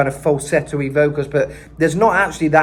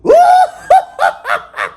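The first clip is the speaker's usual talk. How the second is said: much higher, loud witchy cackle; 8 dB louder than usual. Shown as a demonstration. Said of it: "but like a".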